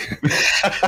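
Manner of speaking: short airy laugh; a quick amused laugh; breathy laugh. Real, not acted. Laughs at idea of commanding kids.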